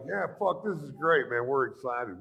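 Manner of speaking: high, thrilled reply; excited. Retold or imitated. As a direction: gush with excitement. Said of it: "So I was like".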